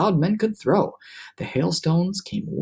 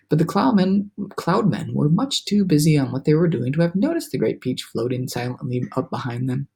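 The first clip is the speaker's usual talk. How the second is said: ordinary delivery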